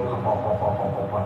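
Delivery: low, soft mumbled chatter; chatting aside. Acted. Distracted listener talking to a friend during the lecture, low murmur. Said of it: "Dia bercakap dengan kawan dia".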